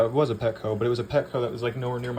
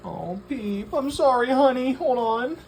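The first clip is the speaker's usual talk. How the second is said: high pet talk; 13 semitones higher, 5 dB louder, slower than usual. Real, not acted. Owner apologizing to her fish Peep, in high baby talk.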